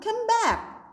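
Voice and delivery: sharp high call to a wandering pupil. Real, not acted. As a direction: call him back, pitch jumping up.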